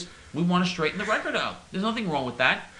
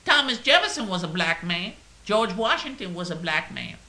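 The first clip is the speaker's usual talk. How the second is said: slower, louder put-on orator; mock preacher voice; 5 semitones higher, 4 dB louder than usual. A comic put-on orator voice, mock earnest. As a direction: proclaim it like a mock civil rights speaker. Mocking voice of activists' claims.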